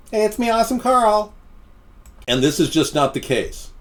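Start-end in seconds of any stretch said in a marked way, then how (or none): 0.1-1.3 much higher goofy put-on voice; Awesome Carl impression. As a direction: play a dopey cartoonish politician. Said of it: "Awesome Carl was hitting on Sarah".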